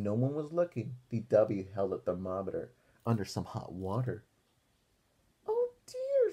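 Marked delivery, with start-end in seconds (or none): 5.5-6.3 put-on high startled old-lady voice; Grandma Thora voice. Grandma reacting to D W's spots, high and startled. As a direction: gasp it out like a fussy grandma. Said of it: "said Grandma Thora".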